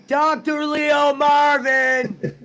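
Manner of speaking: slow drawn-out name through a laugh; laughing echo. Retold or imitated. Host repeating his friend's Bob impression, laughing drawl.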